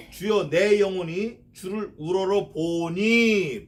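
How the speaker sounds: slow solemn scripture line; slow verse reading. Acted. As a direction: draw out the verse prayerfully.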